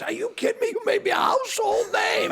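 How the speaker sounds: hammed-up, high-pitched thrilled outburst; excited disbelief. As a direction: imitate an overjoyed man who can't believe the question. Retold player's delighted reaction, acted out.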